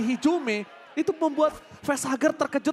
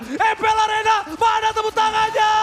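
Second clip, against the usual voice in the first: loud, high call to the arena; crowd hype shout; 10 semitones higher, 5 dB louder than usual. Real, not acted. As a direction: pump up the whole arena crowd. Caster hypes the live arena crowd. (teriakan memanggil penonton arena)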